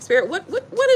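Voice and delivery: usual speaking voice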